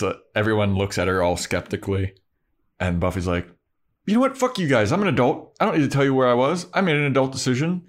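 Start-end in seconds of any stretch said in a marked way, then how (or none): 4.1-7.8 hammed-up teen defiance; defiant. Buffy snaps at Giles and Willow, high and fast. Buffy defending herself to friends.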